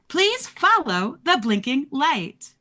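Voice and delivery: slow, high, mechanical announcement; flat robotic PA voice. Acted. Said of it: "a very robotic voice comes on and says".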